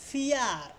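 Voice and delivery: ফিয়ার stretched as "fee-yaaar"; drawn-out iya sound. Shown as a demonstration. Teacher showing the iya rule on ফিয়ার, stretched out. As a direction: sound out ফিয়ার with a long ee-yaa glide. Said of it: "ইয়া উচ্চারণ আসছে".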